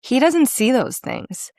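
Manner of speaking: high, animated, pointing out every cue; eager explaining. Retold or imitated. Explaining to her partner how she read the character, high and lively. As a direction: rattle off the obvious signs with relish. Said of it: "I'm like".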